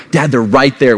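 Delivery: lightly acted kid objection; protesting kid. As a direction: imitate a child insisting to dad. Kids protesting dad's teasing.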